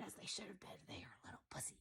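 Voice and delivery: whispered, confiding aside; whispered scorn for the cowards; hushed aside. Real, not acted. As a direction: drop to a whisper.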